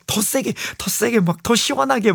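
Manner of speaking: high-pitched urging, mildly acted; imitated demanding wife. DJ imitating wife wanting harder back scratching, high urging.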